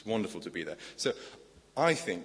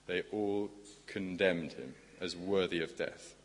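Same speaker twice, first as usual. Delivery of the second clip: usual speaking voice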